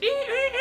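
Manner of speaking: very high squealing beeps; retelling the boat's alarm, high mock beeping; imitated car alarm. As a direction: mimic a shrill boat alarm. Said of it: "It sounds like a car alarm".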